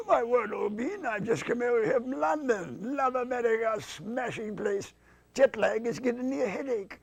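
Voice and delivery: high-pitched British toff; English lord. Acted. English lord just over from London, high British puppet voice.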